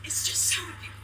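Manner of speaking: fighting back real tears; tearful. Real, not acted. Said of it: "Are those tears I see?".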